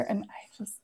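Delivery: breathless whisper of admiration. Real, not acted. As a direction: whisper it, starstruck. Gushing about favourite author.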